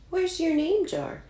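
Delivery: high, puzzled question; boy notices the jar is missing; surprised question. A narrator's reading. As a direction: ask in sudden alarm. Boy asks classmate, worried.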